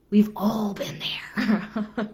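slow, voiceless whisper. Shown as a demonstration. Coach whispering a shared confession to viewers. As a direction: breathe it slowly as a knowing aside. Repeated, second take whispered.

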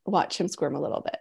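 soft teasing whisper. Real, not acted. Host murmurs a teasing aside to the viewers, whispered. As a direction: breathe it out, half to yourself.

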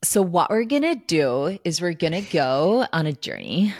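put-on American accent impression. Shown as a demonstration. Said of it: "[name] can do a good American accent".

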